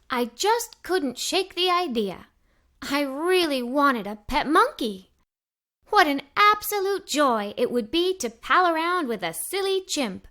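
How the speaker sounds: ordinary delivery, nothing marked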